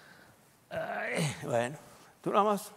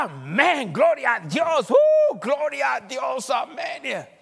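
high, loud shouted praise, much higher; church shouting amen; 9 semitones higher, 8 dB louder, much slower than usual. Pretend. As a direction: whip the congregation into a shout of praise. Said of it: "para que toda esta iglesia diga". (gritos de alabanza, agudos y fuertes)

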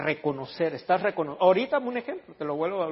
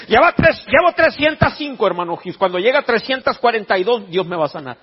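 loud, high, hammed-up tally; mock fervent boasting; 6 semitones higher, 11 dB louder than usual. Believer reports confessions to brother, mock fervent shouting. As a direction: proclaim the count with overdone zeal.

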